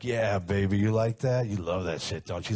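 loud put-on bedroom bravado; swaggering, exaggerated lover voice; talking crazy in bed. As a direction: imitate himself talking dirty, loudly.

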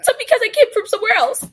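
high, loud, hurried excuse; flustered protest. Real, not acted. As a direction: blurt out a playful defence, fast and high. Flustered excuse after being teased.

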